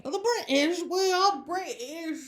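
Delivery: hammed-up British accent, high and loud; mock British. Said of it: "I'm British".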